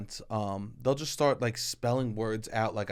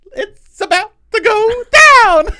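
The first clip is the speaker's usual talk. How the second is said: giddy laughing voice; squealing through laughter; 17 dB louder, slower than usual. Acted. Giddy reaction to flirty text.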